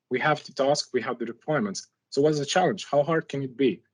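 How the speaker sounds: ordinary talk, nothing marked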